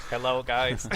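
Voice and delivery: flat text-to-speech robot voice. Pretend. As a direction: greet like a computer voice. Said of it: "I'll use the little robot voice".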